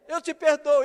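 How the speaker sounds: high, loud acted declaration; emphatic forgiving. Husband giving in to the pastor, loud emphatic declaration.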